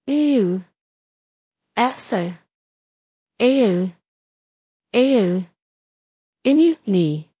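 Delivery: each letter called out slow and loud; spelling out letters. A narrator's reading. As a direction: read every letter like a code being cracked. (binibigkas nang malakas ang bawat titik)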